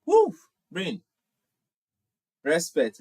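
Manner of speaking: usual speaking voice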